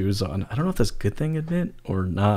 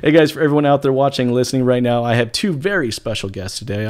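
ordinary talk, nothing marked

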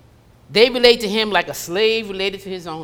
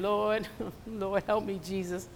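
mock crying prayer; 10 dB quieter than usual. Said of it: "you crying".

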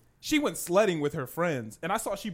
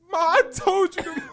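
higher and louder, breaking into laughter; can't hold the laugh; laughing as he retells scolding mom; laughing through words; 12 semitones higher, 8 dB louder than usual. Real, not acted.

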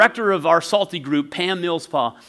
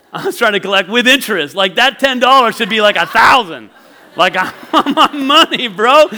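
loud comic demand, rising in pitch; mock indignation; 4 semitones higher, 7 dB louder than usual. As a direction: build to an outraged plea between brothers. Acted demand to collect the bet.

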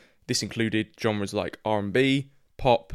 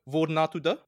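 a host's keen try at a Norwegian accent, pitched higher; 5 semitones higher than usual. Shown as a demonstration. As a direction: ask it like a native from Oslo, in a Norwegian accent. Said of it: "I'm gonna do my best Norwegian accent".

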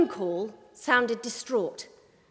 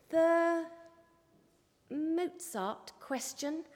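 much softer, slow and hesitant; tentative; 4 semitones higher, 6 dB quieter, much slower than usual. A narrator's reading. Cub reporter asking her boss timidly, softly. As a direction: ask it nervously, barely daring. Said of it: "rather tentatively".

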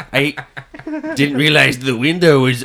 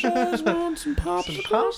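high, soft and slow creep; whispery cartoon creep; creepy pervert voice; 6 dB quieter, slower than usual. Joins the pervert impression.

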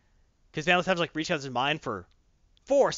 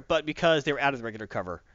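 ordinary talk, nothing marked

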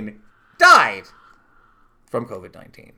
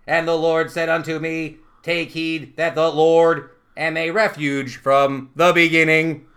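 AI robot voice. Acted. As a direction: intone it evenly like a machine prophet. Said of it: "I assume I'm doing A I voice".

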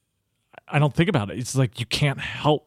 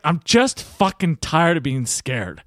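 slow, emphatic retold outburst; fed-up outburst; 3 semitones higher, slower than usual. Lawyer finally admitting his fear to coach, fed-up outburst. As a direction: drag it out, exasperated and raw. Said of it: "Starts crying".

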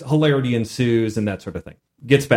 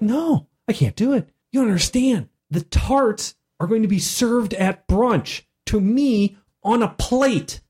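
insistent; 7 semitones higher than usual. Retold or imitated. Oz insists to the confused players.

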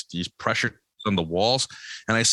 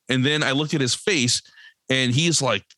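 ordinary talk

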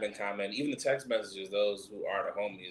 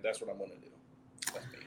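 ordinary delivery

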